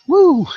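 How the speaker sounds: high, loud whoop; short high-pitched whoop of exasperation. Real, not acted. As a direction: let out a sudden "woo". Venting whoop mid-rant.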